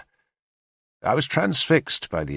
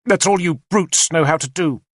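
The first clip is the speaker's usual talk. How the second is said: a sharp, scornful cry, higher and louder; angry outburst; 7 semitones higher, 4 dB louder than usual. A narrator's reading. Queen scorns the warrior's answer, angry and louder. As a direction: lash out at brutes who only fight. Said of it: "she said angrily".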